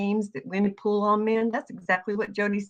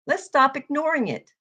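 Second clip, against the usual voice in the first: ordinary delivery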